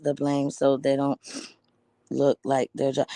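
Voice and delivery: a hint of a smug official, imitated; mocking officials. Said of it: "They will tell you".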